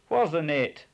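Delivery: demanding. A narrator's reading. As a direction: bark the question. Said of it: "demanded the head bailey".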